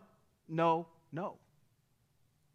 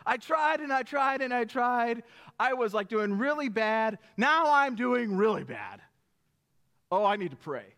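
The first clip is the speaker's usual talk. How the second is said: a hammed-up loud wail of failure; mock desperate lament; 4 semitones higher, 5 dB louder than usual. Preacher voicing a believer at rock bottom, hammed-up desperate wail. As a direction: play the worn-out believer crying out, slightly louder. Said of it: "We're like".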